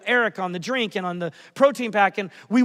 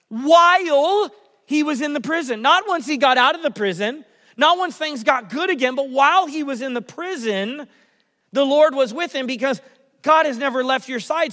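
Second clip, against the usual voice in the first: higher, louder preaching emphasis; preaching at full force; 5 semitones higher, 5 dB louder than usual. Acted. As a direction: drive the point home from the pulpit. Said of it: "preaching emphasis".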